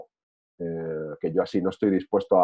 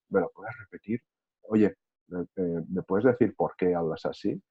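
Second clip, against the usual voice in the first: much softer, calm and hushed questions; speaking softly; 8 dB quieter than usual. Retold or imitated. Replying calmly to someone shouting at you, speaking softly. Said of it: "Y hablas bajito".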